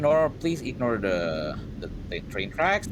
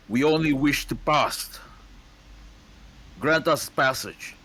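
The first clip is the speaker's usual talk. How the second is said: lightly raised, slow formal plea; a touch of a formal envoy; 6 semitones higher, 4 dB louder, slower than usual. Acted. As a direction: ask for passage with a hint of ceremony. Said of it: "he says".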